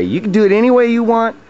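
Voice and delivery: normal talking voice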